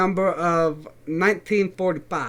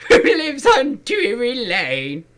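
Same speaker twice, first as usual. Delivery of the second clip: loud, high, hysterical yelling; hysterical shouting; 6 semitones higher, 7 dB louder, slower than usual. Acted. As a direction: scream it out in delight. Acting Gingerbread Man confession, Shrek.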